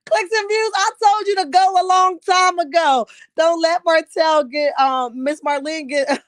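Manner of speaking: high and loud, laughing through the words; rereading the comment while cracking up; tickled. Real, not acted. Host rereads a funny viewer comment, laughing high and loud. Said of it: "I'm so tickled".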